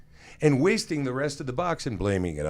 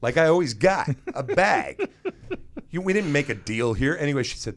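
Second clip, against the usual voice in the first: normal talking voice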